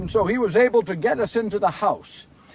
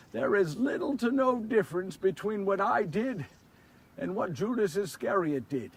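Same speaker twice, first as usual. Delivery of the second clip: much softer, earnest confession; sincere and hushed; 2 semitones lower, 8 dB quieter than usual. A narrator's reading. Peter confiding in his listeners about Judas: soft, sincere. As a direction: lean in and confide it quietly.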